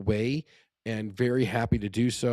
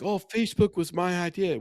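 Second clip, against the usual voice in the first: higher-pitched mocking imitation of an idea-claimer; 7 semitones higher than usual. Host mimicking all those guys who never executed, mocking higher voice. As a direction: make fun of the guy who says it was his. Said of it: "all those guys like".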